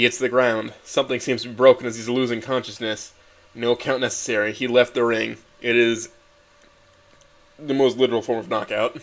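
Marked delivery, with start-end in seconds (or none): none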